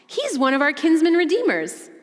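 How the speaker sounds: high, excited, eyes-lit-up recall; sudden realization. Retold or imitated. Naomi realizing Boaz can redeem them, high and excited. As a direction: remember it all at once, thrilled. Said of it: "Naomi remembers".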